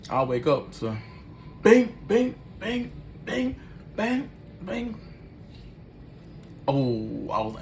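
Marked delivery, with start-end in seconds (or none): 1.6-5.0 high chirpy bing-bing noises; imitated electronic bings. Woken by the noise, mimicking high electronic bings.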